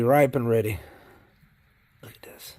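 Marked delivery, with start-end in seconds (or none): 2.0-2.5 hushed whisper over the worms. Real, not acted. Whispering to viewers over the worm bin. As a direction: breathe it out in a whisper.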